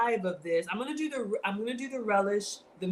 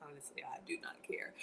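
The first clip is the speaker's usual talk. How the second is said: breathy, voiceless confession; whispered aside; 6 semitones lower, 17 dB quieter than usual. Real, not acted. Whispering to viewers that she doesn't care about gossip. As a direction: drop to a hush and share it.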